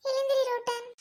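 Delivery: high-pitched, plaintive voice; pleading friend. Acted. Friend Andal pleading with Rottan to get up, plaintive. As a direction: coax an unconscious friend to rise. Begging unconscious friend to wake.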